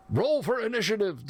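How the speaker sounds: high-pitched, eager call with a big smile; excited cry. Acted. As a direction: cry out, eager for combat, without shouting. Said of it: "the dwarf cried out with a big smile".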